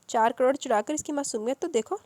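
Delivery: a touch of angry sarcasm; mildly scornful officer. Acted. Lady officer mocks Darakhshi, lightly angry. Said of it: "غصے سے کہا".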